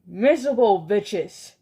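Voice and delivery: loud scornful yell; angry yell. Acted. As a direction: spit contempt at the crowd. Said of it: "she yelled".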